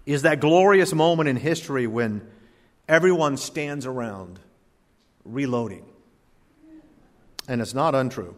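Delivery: ordinary talk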